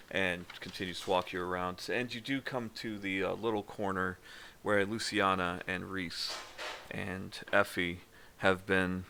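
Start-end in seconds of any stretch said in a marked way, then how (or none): none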